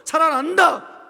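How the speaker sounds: loud, high, drawn-out declaration; booming voice of Jesus declaring life; emphatic proclamation. Acted. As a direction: proclaim it forcefully, stretching the words. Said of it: "예수님은 뭐라고 말해요?".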